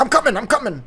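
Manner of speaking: high-pitched hurried rescuer; mock urgent reply. Man answers his friend's cries in the forest, mock urgency. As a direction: imitate rushing to someone's aid.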